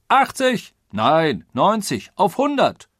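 high, loud calls like bidders at auction; excited outbidding. A narrator's reading. Passengers outbidding each other on the odds, excited. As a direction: shout each figure over the last.

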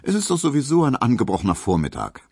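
high, quick coaxing; coaxing plea. A narrator's reading. Suitor persuades Hedwig to stay, coaxing. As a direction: talk her round in a hurry. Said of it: "bat Emil Kubinke".

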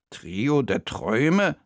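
slow, puzzled echo; questioning repetition. A narrator's reading. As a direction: repeat the name slowly as a question. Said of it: "wiederholte Bertha". (langsam und fragend wiederholt)

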